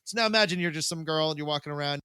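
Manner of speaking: ordinary talk, nothing marked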